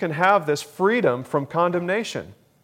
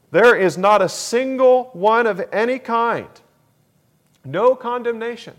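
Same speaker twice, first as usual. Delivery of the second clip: forceful preaching; 8 semitones higher, 6 dB louder than usual. Real, not acted. Preacher driving home the point.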